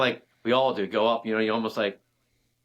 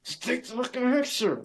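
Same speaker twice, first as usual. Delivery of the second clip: strained mock yell. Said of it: "and I'm like".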